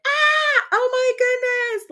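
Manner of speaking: high, loud panicked cry; a hammed-up rider terrified on the drop; mock freaking out. Coach mimicking a panicked rider, high loud shriek. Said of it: "like, freaking out".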